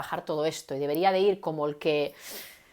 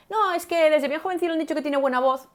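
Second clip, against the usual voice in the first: mocking naive bystander; 9 semitones higher, 4 dB louder than usual. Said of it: "No es solo".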